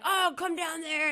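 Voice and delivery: lightly acted tough-guy taunt; mock aggro. Stranger challenges the group below, mock aggro. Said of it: "tries to be, like, all aggro".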